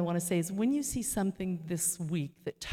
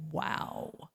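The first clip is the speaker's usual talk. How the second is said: breathless whispered wow; a hushed wow of wonder; awed wow; 5 dB quieter than usual. Retold or imitated. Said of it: "I was like".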